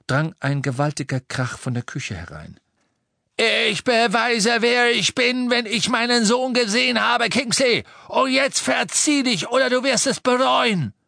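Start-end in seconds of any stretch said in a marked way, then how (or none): 3.7-10.9 high, loud, enraged yelling; father yelling to get past; furious shout. A narrator's reading. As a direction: bellow a threat at full pitch. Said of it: "noch nie so schreien hören".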